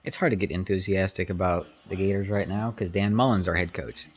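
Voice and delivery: ordinary talk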